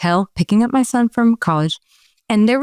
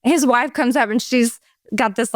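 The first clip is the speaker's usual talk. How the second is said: ordinary talk, nothing marked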